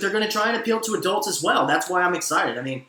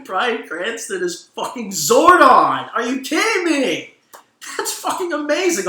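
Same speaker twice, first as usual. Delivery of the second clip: thrilled disbelief; 6 semitones higher than usual. Real, not acted. Thrilled news reveal, slow then high.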